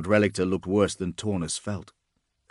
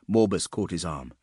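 ordinary delivery, nothing marked

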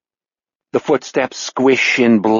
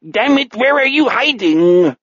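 high, angry pseudo-German call; exasperated villain with a mutated German accent; frustrated non-German accent; 10 semitones higher than usual. Acted. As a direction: demand it high-pitched in a weird German-like accent. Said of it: "The more angry and frustrated".

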